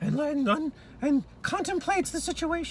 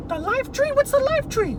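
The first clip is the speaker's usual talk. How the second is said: surprised; 9 semitones higher, 7 dB louder than usual. Acted. Child character alarmed by tree.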